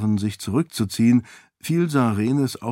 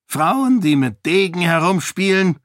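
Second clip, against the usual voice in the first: scoffing king; 8 semitones higher, 5 dB louder than usual. A narrator's reading. King scoffs at sword idea.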